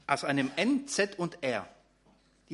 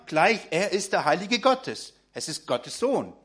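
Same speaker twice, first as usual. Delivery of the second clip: ordinary delivery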